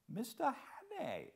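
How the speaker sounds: put-on a faltering old man greeting a stranger; hesitating old host. A narrator's reading. Old host greets Hannay, a touch hesitant. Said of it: "he said hesitatingly".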